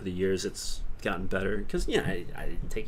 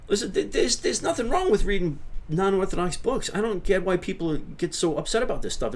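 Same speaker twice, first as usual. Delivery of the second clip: much higher, mock-opinionated character voice; put-on high voice; 9 semitones higher than usual. Streamer answering a viewer on non-orthodox books, put-on high voice. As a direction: pitch it way up, like a character giving a verdict.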